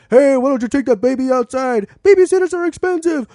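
high, loud mock argument; mock shouting match. Retelling the theater couples' fight, high and loud. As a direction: yell it like a quarrel across rows. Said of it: "me and my friends, like".

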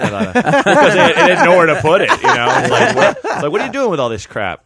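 laughing loudly while telling it; can't keep a straight face. Real, not acted.

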